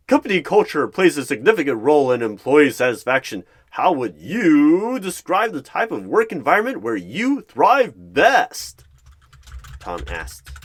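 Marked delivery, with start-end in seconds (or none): 0.1-8.8 big, loud, higher game show host; boastful game show voice. Acted. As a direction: boom it out like a cheesy quiz host. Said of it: "big, boastful voice like he is on some weird game show".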